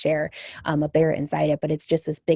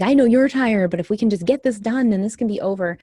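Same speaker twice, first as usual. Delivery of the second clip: higher, louder tearful urging; tearful coaxing; 5 semitones higher, 6 dB louder than usual. Retold or imitated. Mother crying while urging her tired son on. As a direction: coax him through tears. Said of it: "And I just cried".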